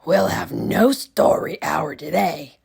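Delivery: loud harsh witch voice; Miss Swamp stern. Acted. Miss Swamp cancelling story hour, loud harsh witch voice. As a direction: deny them coldly. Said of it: "said Miss Swamp".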